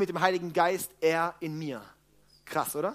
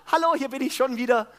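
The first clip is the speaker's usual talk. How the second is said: higher, louder, cheery hello, half-laughing; mock newborn greeting; 6 semitones higher, 4 dB louder than usual. Preacher plays the reborn person greeting again, cheerful mock voice. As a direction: pop back in like a silly baby. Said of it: "Ich kann ja nicht sagen".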